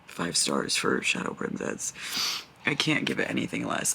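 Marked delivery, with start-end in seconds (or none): 0.1-1.9 low, slow, breathless whisper of a rating; worn-out mutter. Real, not acted. Drained reader muttering her rating to the camera, hoarse whisper. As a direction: croak it out, drained and defeated.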